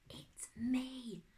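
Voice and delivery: voiceless, soft whisper; mouthed whisper. A narrator's reading. Hidden girl showing herself to her friend, mouthed whisper. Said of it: "she mouthed".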